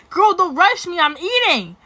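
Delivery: loud, high, playful snap; mock snap. To the guy rushing her, a loud playful snap. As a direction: tell him off loudly but in fun. She snaps at him, loud.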